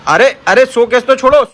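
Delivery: loud, high startled call; playful loud protest. Real, not acted. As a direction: cry out as she grabs it. Child grabs the showcase.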